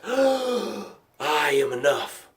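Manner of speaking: soft, drawn-out words after a gasp; breath of revival. Acted. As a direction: let it out slowly on the released breath. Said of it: "And you take these breaths".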